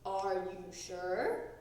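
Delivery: low, slow, doubtful grown-up. A narrator's reading. Mugwort doubting Froggy can paddle. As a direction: raise an eyebrow at him.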